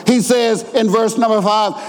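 usual speaking voice

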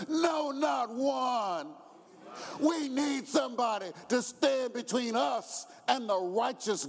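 high, slow shouted preaching; whooping preacher climax; preaching at full force. Acted. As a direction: lift the church with it.

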